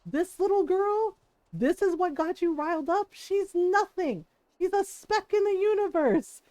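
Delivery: mock sneer, as if she is nothing; scornful dismissal. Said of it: "or would he be like".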